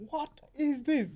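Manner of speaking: high, loud shocked exclaim; retold shock at the freezing weather; shocked what is this. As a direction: recoil at the cold in disbelief. Shocked at cold weather on landing.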